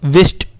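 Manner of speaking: विस्ट with a W sound at the start; wrong reading. Shown as a demonstration. Teacher sounding out the wrong word विस्ट for a child. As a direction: read विस्ट as wist, sounding the W. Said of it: "ये भी हमारा गलत लग रहा है सुनने में".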